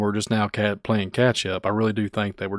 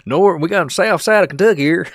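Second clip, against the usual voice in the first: high, loud hammed-up Kentucky hillbilly voice, laughing through it; hillbilly local accent; 9 semitones higher, 9 dB louder than usual. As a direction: shout it like an excited Kentucky local. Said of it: "full-blown hillbilly".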